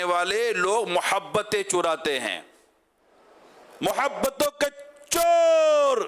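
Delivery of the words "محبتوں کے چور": rising to a high, loud held shout on چور; rousing slogan shout. Shown as a demonstration. Preacher leads the crowd in a chant, shouting. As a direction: call out محبتوں کے چور like a rally chant. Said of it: "نعرہ زوردار لگے".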